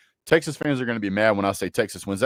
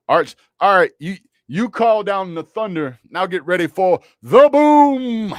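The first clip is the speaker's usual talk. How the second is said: mock hype; 8 semitones higher, 7 dB louder than usual. Hyping Arch Manning mockingly.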